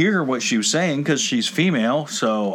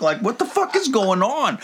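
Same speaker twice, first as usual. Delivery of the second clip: high-pitched baffled outburst; exasperated disbelief; 9 semitones higher than usual. Retold or imitated. Customer reliving being on the clock, exasperated and baffled. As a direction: pitch it up in retold panic. Retelling his panic at the timer.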